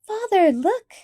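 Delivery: high, loud eager cry; excited cry. A narrator's reading. Felicity rushing to her father, excited cry. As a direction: burst out with the news. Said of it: "she cried".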